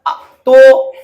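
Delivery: a sudden loud "आ"; a loud shout; loud exclamation. Shown as a demonstration. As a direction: burst out loudly. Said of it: "यदि कोई बोले".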